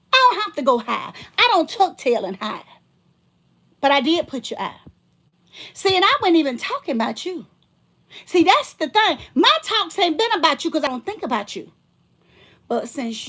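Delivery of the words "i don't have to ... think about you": higher and louder, defiant; heated rant. Real, not acted. Ranting at the ex who keeps messaging, heated. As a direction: throw it at him, fired up.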